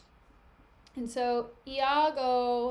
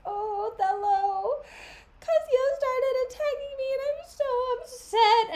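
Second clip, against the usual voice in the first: very high, loud whining complaint; whiny tattletale; 13 semitones higher, 5 dB louder than usual. Retold or imitated. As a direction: tattle to the boss in a shrill whine. Montano complaining dramatically to Othello.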